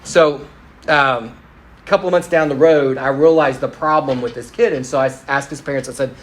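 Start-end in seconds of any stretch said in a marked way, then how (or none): none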